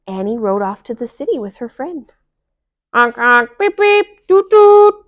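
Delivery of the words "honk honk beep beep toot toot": high, loud honk and beep noises; honking car horns. Acted. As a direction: blare like city traffic. Imitated traffic horns.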